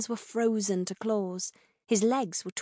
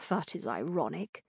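king's dry irony; 4 semitones lower, 7 dB quieter than usual. A narrator's reading. Bedridden king replying to Althea's lecture, soft dry irony. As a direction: let it drop quietly and dryly.

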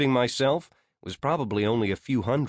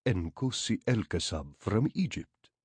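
put-on lilting Arabic accent, a little softer; Nkosi El Kasab's Arabic accent; 3 semitones lower, 5 dB quieter than usual. A narrator's reading. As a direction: introduce yourself with an Arabic lilt. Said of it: "the lilt of an Arabic accent".

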